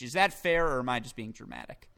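usual speaking voice

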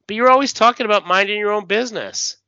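high, loud, fast objection; acted indignant protest. Bar patron objects to bartender's interference, indignant protest. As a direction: protest indignantly, in comic-book style.

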